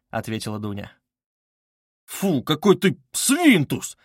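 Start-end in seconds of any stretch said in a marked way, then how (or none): 2.1-4.0 slow, high-pitched indignant scolding; drawn-out embarrassed rebuke. A narrator's reading. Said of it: "произнёс страшно сконфузившийся и покрасневший Разумихин".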